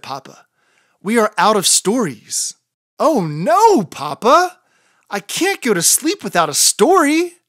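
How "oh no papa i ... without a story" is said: pleading whine. Acted. Chicken pleads for story.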